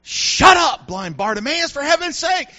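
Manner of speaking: loud, high burst of exasperation; exasperated rebuke. Retold or imitated. Disciples telling blind Bartimaeus to shut up, exasperated. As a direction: snap at him to shut up, acted. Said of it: "told him to shut up".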